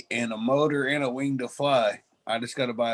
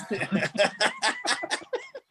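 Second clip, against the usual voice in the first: high squealing laugh. Real, not acted. Big laugh.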